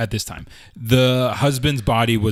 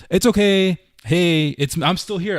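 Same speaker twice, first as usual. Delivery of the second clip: reassuring; 7 semitones higher than usual. Retold or imitated. Soothing scared child on phone.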